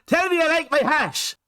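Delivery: high, mocking fake Irish accent; bad Irish accent. Reviewer mimicking the actor's hat line, bad Irish accent. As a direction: exaggerate a dreadful film Irish accent.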